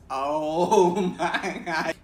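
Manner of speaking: laughing it out, slow and loud; real laugh breaking through the words. Real, not acted. New teacher laughing at her disastrous first year, slow. Laughing at how it got worse.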